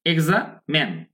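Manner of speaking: এক্সামেন split slowly as "egza, men"; slow, pause before the "men" of এক্সামেন; syllable-split pronunciation. Shown as a demonstration. Teacher asking learners to notice, syllable-split pronunciation. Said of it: "এবার খেয়াল করুন".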